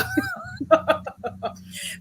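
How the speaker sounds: squealing high laugh. Real, not acted. Laughs after retold texts.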